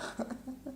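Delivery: soft high giggle; quick breathy laugh at own yelling; real chuckle. Real, not acted. Laughs between her yelled echoes.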